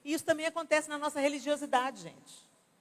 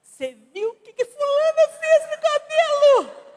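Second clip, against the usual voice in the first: very high, loud, slow gossip squeal; gossiping hypocrite; 12 dB louder than usual. Acted. Hypocrite gossips about the friend's haircut, shrieking high mockery. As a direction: squeal the gossip behind her back. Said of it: "Isso é hipocrisia".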